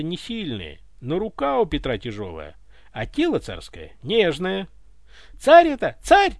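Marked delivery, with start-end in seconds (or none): 5.4-6.3 high, loud alarmed cry; yelling. A narrator's reading. Butler rushes in to save the tsar, yelling. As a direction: yell it high and urgent. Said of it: "заорал подоспевший дворецкий".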